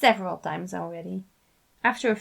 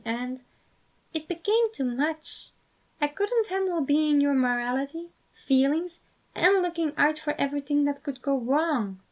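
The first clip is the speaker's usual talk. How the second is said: higher, gentle fatherly voice, sad memory; Patton voice; 7 semitones higher than usual. A narrator's reading. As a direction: Patton explaining his past, high and wistful. Patton's long tender speech.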